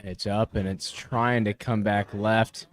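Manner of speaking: live play-by-play, building up; golf commentator. Real, not acted. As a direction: call the shot as it lands. Live play-by-play of approach shot.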